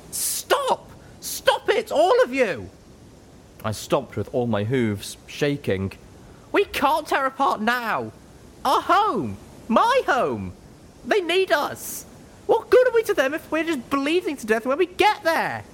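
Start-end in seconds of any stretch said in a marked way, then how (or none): 0.1-2.7 much higher, shouting to stop a quarrel; high, shaking cry to stop the fight; desperate shout. Acted. Littlepip breaks up the fight. 6.5-15.6 much higher, shouting to stop a quarrel; high, shaking cry to stop the fight; desperate shout. Acted. Littlepip pleads, shaking.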